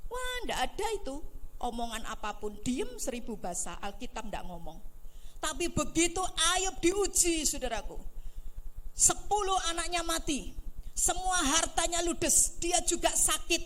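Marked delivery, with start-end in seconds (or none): 5.4-13.6 higher and louder dramatic telling; preaching rise. Real, not acted. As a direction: hammer each loss. Preacher building Job's trial.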